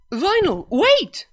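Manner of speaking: high, loud shout after a fleeing friend; calling after her. A narrator's reading. Narrator shouting after Vinyl as she runs off. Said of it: "I called after her".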